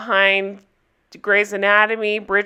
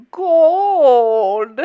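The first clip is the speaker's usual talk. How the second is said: drawn-out, louder mock Dutch accent; one long word in a silly Dutch accent; Goldmember; 3 semitones higher, 5 dB louder than usual. Recapper likening the accent to a film villain, mock Dutch accent. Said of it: "Austin Powers Gold member".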